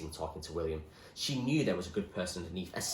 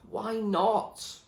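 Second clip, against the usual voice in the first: desperate; 13 semitones higher, 6 dB louder than usual. Acted. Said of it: "asked Zoe desperately".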